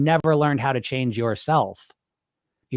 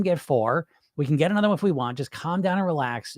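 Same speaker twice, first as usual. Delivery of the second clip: ordinary talk, nothing marked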